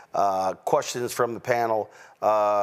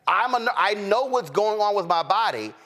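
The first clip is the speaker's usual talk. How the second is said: retold yelling; 11 semitones higher than usual. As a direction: imitate her urgent protest in a raised pitch. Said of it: "yelling, and sc".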